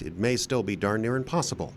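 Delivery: ordinary talk, nothing marked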